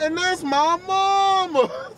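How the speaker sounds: slow drawn out Southern mama; accentuated mama. Retold or imitated. Host repeats the rapper's line, drawling out mama. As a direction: stretch out mama, Southern style. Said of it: "he accentuates the mama".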